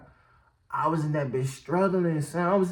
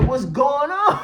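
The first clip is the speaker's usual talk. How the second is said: high, raised-voice confused question; retold bewilderment; 7 semitones higher, 5 dB louder, slower than usual. Storyteller replaying getting up off the floor, mock bewildered shout. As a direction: call it out, mimicking dazed alarm.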